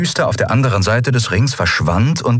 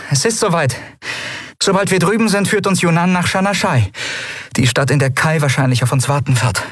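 put-on higher, quick young captain pressing on; brisk captain; 10 semitones higher than usual. A narrator's reading. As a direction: hurry the crew along, lightly. Endriel voiced in story.

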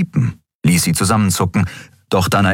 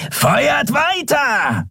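high, slow roaring command; bellowed order; much slower than usual. A narrator's reading. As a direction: roar the order across the field. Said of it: "brüllte der Schattenskrier".

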